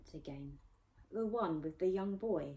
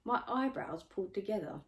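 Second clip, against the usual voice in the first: ordinary delivery, nothing marked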